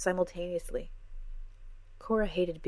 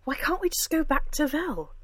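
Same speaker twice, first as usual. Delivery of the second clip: demanding; 10 semitones higher, 6 dB louder than usual. A narrator's reading. Cora keeps demanding.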